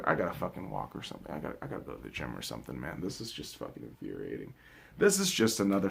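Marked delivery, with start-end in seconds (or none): 0.0-4.5 much softer, lower, muttering; deflated mutter. Real, not acted. Fan deflated after ranting about his team, a soft low mutter. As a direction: let the anger drain into a mutter.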